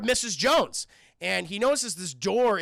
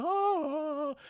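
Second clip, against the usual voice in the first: drawn-out high 'oh'; slow-motion effect; 8 semitones higher than usual. Retold or imitated. He gets up in slow motion, long swelling wail. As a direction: imitate dramatic slow-motion soundtrack. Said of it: "slow motion".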